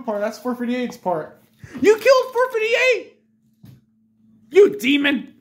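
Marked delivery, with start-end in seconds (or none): 1.8-5.3 much higher, loud accusing shout; hammed-up outraged shriek; shocked yell. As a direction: cry out in mock horror. Outburst over the ice cream.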